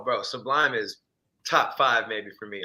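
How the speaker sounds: ordinary talk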